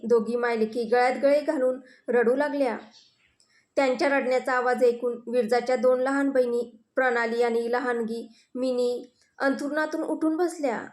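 usual speaking voice

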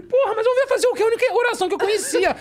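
high, loud indignant protest; exasperated self-defence. Retold or imitated. Husband defending his prayer to his wife, exasperated. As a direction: re-enact pleading your case.

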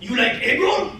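building to a high shouted sales pitch; hibachi chef. Retold or imitated. Hibachi chef pitching egg rolls to diners, rising shout. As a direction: hype it up, rising to a loud shout.

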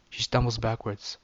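ordinary delivery

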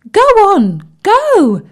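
high, loud, eager squeal; excited. A narrator's reading. Tim the tadpole urging Bertie on, excited. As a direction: bounce on the words, very high and loud. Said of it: "said Tim excitedly".

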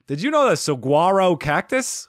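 mock Mark Wahlberg in a Boston accent, a bit hammed. Quoting a prison movie scene, in a Boston accent. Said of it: "In the Boston accent".

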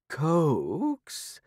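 high, scornful rising "Coax?"; disbelieving cat echo; incredulous repeat. Acted. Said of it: "Tabitha repeated".

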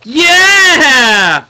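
loud long victory yell; cheering. Real, not acted. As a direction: shout in triumph. Cheer after winning.

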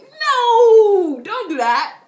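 very loud, high "no"; emphatic refusal. Acted. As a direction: shout them down with one long no. Forbidding the imagined crying plan.

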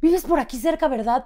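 nosy clerk pressing for details; prying shop girl. Retold or imitated. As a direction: push the question.

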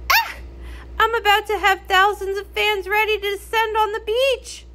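high, frantic rush; panicked. Acted. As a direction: squeal it in a panic. Panicked pop star before concert.

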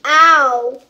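sharp loud pained ow. Real, not acted. Kid yelps in pain while mixing. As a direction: cry out at the sting. Slime hurts.

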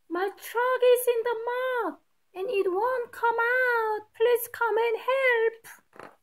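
a storybook plea from far away; shouting for help. Acted. Stuck dog calling out for help, high pleading voice. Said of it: "the ear that hears the shout".

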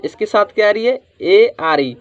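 ordinary talk

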